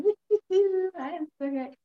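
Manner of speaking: high, hammed-up gushing woo; mock gushing. Mocking the gushing love-and-light type. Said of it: "I don't go around going".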